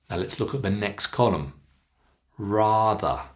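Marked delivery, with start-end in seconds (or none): 2.4-3.2 "Rather" said rahther; long ah. Shown as a demonstration. Teacher models word for practice, long ah in "Rather". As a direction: stretch the first vowel of "Rather" to ah. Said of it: "It's the long ah sound".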